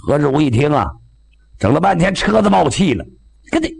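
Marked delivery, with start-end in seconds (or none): none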